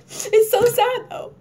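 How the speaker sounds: high, slow, whiny wail; mock weepy. Crying over Titanic, high mock wail. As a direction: wail it like you're about to cry. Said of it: "and I'm like".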